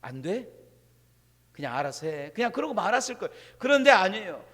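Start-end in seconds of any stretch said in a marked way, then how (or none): 0.0-2.3 soft, low and sheepish; sheepish mutter. Pretend. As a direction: mumble it and give up. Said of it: "누가 들을까 봐".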